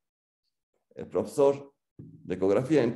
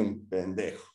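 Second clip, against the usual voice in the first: affectionate insult; 2 semitones lower, 6 dB quieter, slower than usual. Retold or imitated. Dad fondly ending his advice, soft and teasing. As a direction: tease him lovingly under your breath. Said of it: "con cariño".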